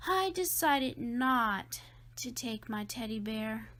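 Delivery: ordinary delivery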